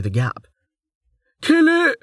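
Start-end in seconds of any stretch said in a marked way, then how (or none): 1.4-2.0 high, frantic shout; cried out. A narrator's reading. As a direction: yell an alarm to the fighters. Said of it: "someone cried".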